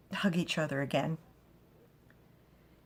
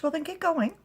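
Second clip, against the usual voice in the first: higher, louder shooing; high mock-bossy mother; 12 semitones higher, 6 dB louder than usual. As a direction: shoo him off playfully. Glyneth shooing him.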